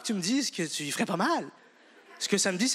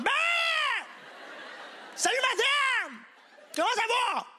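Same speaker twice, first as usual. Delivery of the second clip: creepy catcall. Retold or imitated. Pervert catcalling.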